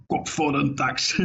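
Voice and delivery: half-hearted imitation of a shouted slogan, stretched slowly; mock shouting. Host mimics a politician shouting a slogan, mildly mock shout. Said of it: "zo schreeuwen van".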